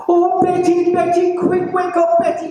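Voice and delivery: high-pitched, urgent call to Betty; high alarmed voice. A narrator's reading. Francie calls his wife Betty in a high panic.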